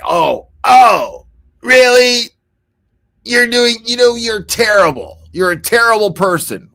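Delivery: high, loud mocking shout as the drunk husband; mock drunk rant. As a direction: ham up the drunk guy yelling back at her.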